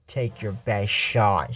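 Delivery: very low, slow challenge; low dare. Acted. Mother dares daughter to attack, low and slow. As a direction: drop low and stretch out the dare.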